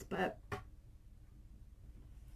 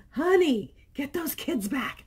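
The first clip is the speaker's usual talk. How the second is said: loud high alarm; reading a viewer's panicked line aloud, mock shout; 8 semitones higher, 7 dB louder than usual. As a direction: yell for the kids back, hammed-up.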